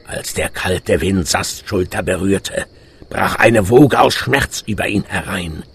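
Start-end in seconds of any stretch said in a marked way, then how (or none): none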